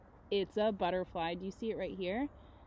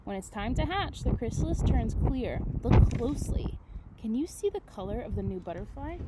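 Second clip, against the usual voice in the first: put-on reading to children; 8 dB louder than usual. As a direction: read it like a big picture book to the class. Reads book aloud to class.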